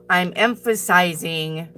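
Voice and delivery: slow, deliberate stress on "emphasizing"; emphatic. Shown as a demonstration. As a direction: spell out "emphasizing" slowly, syllable by syllable. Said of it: "but I'm emphasizing".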